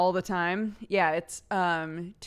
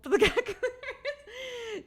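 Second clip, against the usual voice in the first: laughing through it; slower than usual. Real, not acted. Laughing mid-gibberish.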